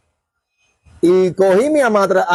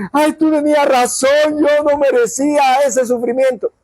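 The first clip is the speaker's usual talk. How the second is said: higher, slow, put-on tearful whine; mock weepy plea; 5 semitones higher than usual. A friend returns admitting you were right, mock tearful. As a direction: play a friend coming back crying to admit it. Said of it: "regresará llorando y decirte".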